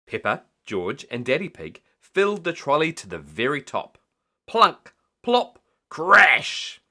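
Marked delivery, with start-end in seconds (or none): 4.5-6.8 high, loud clattering noises; sound effects. A narrator's reading. Falling groceries filling the trolley, loud sound words. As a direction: make the groceries crash.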